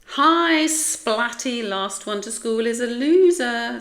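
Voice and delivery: high, loud taunting greeting; show-off's high, teasing holler; cocky grin. A narrator's reading. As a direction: call out a gleeful challenge, grinning. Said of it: "said Spike with a grin".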